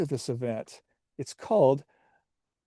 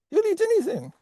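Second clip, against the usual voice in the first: very high French squeak; terrible French accent; faster than usual. Acted. Potato salad joking about the uncle, high French accent. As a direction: toss it off in cartoon French. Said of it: "you have a terrible French accent".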